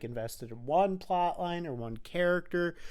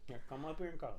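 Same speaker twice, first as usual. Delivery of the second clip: soft pet talk; 11 dB quieter, much faster than usual. Real, not acted. Cooing at the dog.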